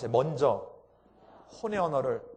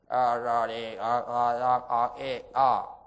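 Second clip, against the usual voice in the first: lower, slurred with lips frozen; lips held still; 6 semitones lower, 5 dB louder, much slower than usual. Shown as a demonstration. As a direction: talk using only the tongue. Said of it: "이렇게".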